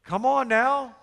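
rally the crowd to answer; urging. Real, not acted. Preacher urging the congregation to respond, rallying.

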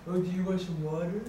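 put-on loud, sing-song baby talk. Shown as a demonstration. Teacher shows the class how she talks to a baby, cooing. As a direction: coo it like talking to an infant. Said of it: "I don't talk like this".

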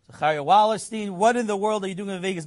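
mock-stern rabbi repeating himself; insistent repeat. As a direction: repeat it, demanding an answer.

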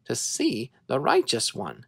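ordinary delivery, nothing marked

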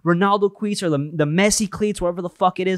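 high-pitched enthusiastic sales patter; happy, energetic. Retold or imitated. New store worker chatting to customers, high-pitched eager excitement. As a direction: gush like a thrilled new employee. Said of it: "I was just as energetic".

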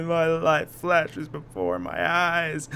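very slow, drawn-out mock dying voice; hammed-up last words. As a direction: stretch every word as if fading away. Pretend dying in the game.

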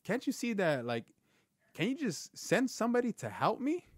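high, desperate plea to God; pleading prayer. Retold or imitated. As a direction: beg God for help, voice climbing high. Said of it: "I'm crying out to, to God".

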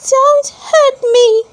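high, slow, pleading cry from a tiny voice; little voice crying out. A narrator's reading. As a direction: cry out like a small scared voice inside the log. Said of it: "a little voice cry".

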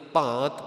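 ਭਾਂਤ with a nasal long "aa"; "bhaa(n)t", vowel nasalised before the t. Shown as a demonstration. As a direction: let the vowel of ਭਾਂਤ go through the nose. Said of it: "ਬਿੰਦੀ ਦੇ ਸਹਿਤ ਉਚਾਰਨ".

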